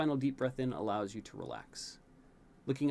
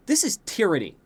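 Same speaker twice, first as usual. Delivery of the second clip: higher, louder indignant sulk; mock outrage; 8 semitones higher, 8 dB louder than usual. A narrator's reading. Prince fuming over lost free time, indignant. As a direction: play it like a wronged teen.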